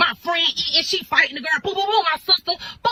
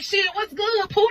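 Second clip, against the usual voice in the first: surprised greeting; 8 semitones higher, slower than usual. Retold or imitated. Greeting rivals in a fight.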